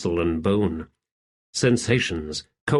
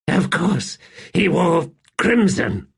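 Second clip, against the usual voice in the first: bitter realisation; 7 semitones higher than usual. Acted. Rulad voiced.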